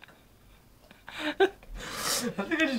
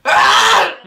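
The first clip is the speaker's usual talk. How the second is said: loud high gasping sneeze wind-up; loud sneeze build-up; 12 semitones higher, 14 dB louder than usual. Real, not acted. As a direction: strain toward a big sneeze. Straining to sneeze.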